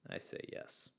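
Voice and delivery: whispered aside. Real, not acted. Answering own question aside.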